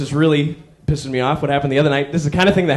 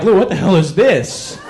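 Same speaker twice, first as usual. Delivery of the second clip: slow, baffled self-mockery; mocking himself; 3 semitones higher, slower than usual. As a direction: drag it out, scolding yourself. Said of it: "And I'm like".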